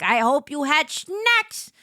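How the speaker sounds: ordinary talk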